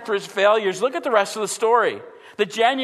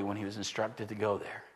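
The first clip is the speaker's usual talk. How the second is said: whispered aside; 9 semitones lower, 12 dB quieter than usual. Real, not acted. Preacher drops voice mid-sermon.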